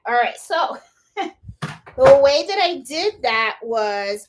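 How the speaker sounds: normal talking voice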